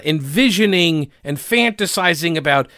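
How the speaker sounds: ordinary talk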